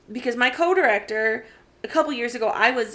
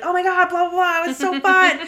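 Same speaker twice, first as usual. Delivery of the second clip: higher, louder mock gush; playful nostalgic blah blah, pitched up; 8 semitones higher, 5 dB louder than usual. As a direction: gush like old friends reminiscing. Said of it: "we're like".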